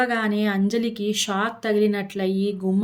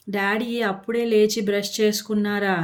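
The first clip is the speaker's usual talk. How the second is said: usual speaking voice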